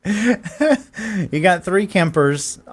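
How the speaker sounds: chuckling through the words; laughing. Real, not acted. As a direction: laugh while saying it. Laughing at appointees.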